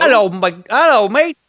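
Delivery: high, loud mock-Cockney hail. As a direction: greet them in a hammed-up musical-film Cockney. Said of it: "he puts on the big Cockney accent he had in Mary Poppins".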